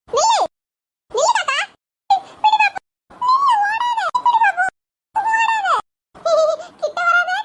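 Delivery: high, loud calls to stop it; shrill chase cries; shouting after a crow. Acted. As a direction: yell at the crow to stop running.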